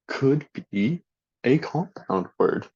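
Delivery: normal talking voice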